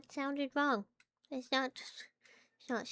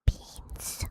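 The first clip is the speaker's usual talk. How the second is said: right in your ear; 4 dB louder than usual. Shown as a demonstration. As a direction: close whisper into the mic. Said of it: "Whisper into your ear".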